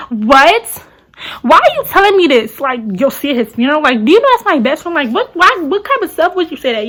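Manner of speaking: incredulous protest at a friend; indignant disbelief. Retold or imitated. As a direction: relive the argument, voice jumping up. Quarrel over friend's death news.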